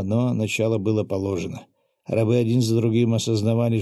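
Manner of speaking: usual speaking voice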